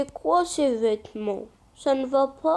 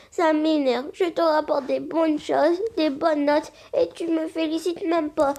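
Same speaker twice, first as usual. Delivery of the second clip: normal talking voice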